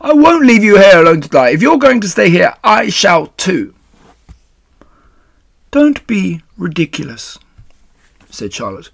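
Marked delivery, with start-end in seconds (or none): 0.0-3.8 high, loud protest; distressed shout. A narrator's reading. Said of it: "shouted Wilbur". 5.7-7.4 high, composed reproof; chiding spider. Acted. Spider rebukes the frantic pig.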